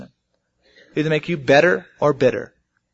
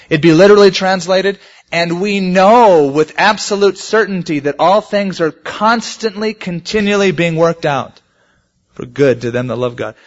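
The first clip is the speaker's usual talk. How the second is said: emphatic preaching; 4 semitones higher, 5 dB louder than usual. Acted.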